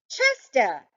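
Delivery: high, calling out lost. A narrator's reading. Harold calls Chester, lost on the chair, high calling out. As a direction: call out for the missing cat. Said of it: "I cried".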